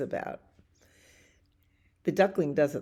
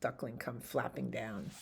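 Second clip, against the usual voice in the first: usual speaking voice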